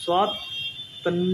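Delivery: स्वातंत्र्य drawn out slowly while reading; slow spelling out. Shown as a demonstration. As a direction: stretch स्वातंत्र्य as you think it through. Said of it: "स्वा जो तय है".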